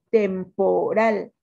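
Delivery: "Temporal" slow and loud as tem, po, RAL; syllable-by-syllable stress demo. Shown as a demonstration. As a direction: sound out "Temporal", drawing out RAL. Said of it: "Cuál es su sílaba tónica".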